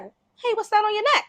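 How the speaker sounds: high-pitched, curious challenge; startled wolf. Acted. Wolf noticing the dog's collar, startled. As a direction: play a wolf suddenly puzzled by what he spots.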